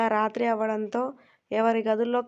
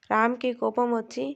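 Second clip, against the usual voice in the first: ordinary delivery, nothing marked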